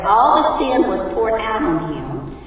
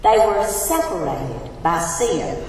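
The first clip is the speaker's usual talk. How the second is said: normal talking voice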